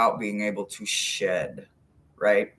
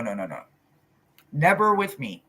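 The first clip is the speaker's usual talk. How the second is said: ordinary delivery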